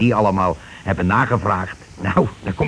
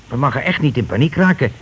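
ordinary talk

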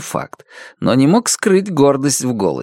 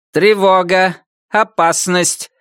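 high metallic robot alarm voice; rusty robot; 7 semitones higher, slower than usual. Acted. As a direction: sound the alarm like a tinny robot. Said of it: "Металлический голос робота звучал".

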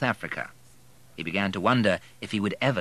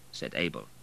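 plain reading voice

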